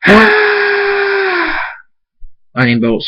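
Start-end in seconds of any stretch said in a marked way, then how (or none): 0.1-1.6 sustained, very high scream; drawn-out high battle scream; long high scream. A narrator's reading. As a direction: hold the scream for seconds. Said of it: "Thunderstorm and Darkstar scream".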